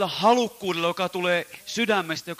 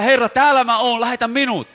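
higher, loud cry; 6 semitones higher, 8 dB louder than usual. Retold or imitated. Interpreter cries out the prophet's answer, higher and loud. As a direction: call out like the prophet. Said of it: "Vaan nyt hän sanoo".

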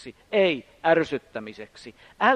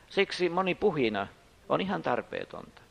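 ordinary talk, nothing marked

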